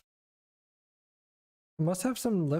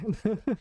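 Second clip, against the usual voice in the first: happy laugh; 6 semitones higher than usual. Real, not acted. Joyful laugh after success.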